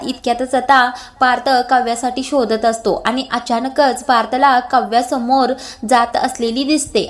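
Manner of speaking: plain reading voice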